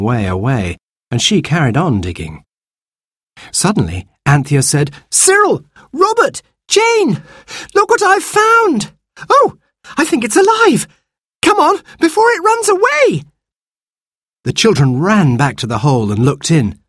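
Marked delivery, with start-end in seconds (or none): 5.1-13.2 put-on very high, urgent child's cries; excited girl calling out. Sister finds something alive and calls siblings, excited high shouts. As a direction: call the others over in high-pitched excitement.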